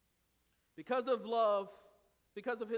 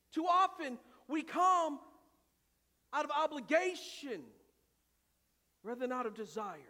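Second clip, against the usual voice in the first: loud, high, driving sermon delivery; full-force preaching; 7 semitones higher, 10 dB louder than usual. Acted. Pastor preaching to his church, loud and impassioned. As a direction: preach it out hard to the congregation.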